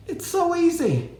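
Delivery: high-pitched exasperated plea. Real, not acted. Shopper exasperated at people who choose not to wear masks.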